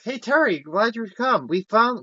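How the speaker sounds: high-pitched put-on kid voice; imitated eager greeting. Retold kid greeting Terry on return, high eager greeting.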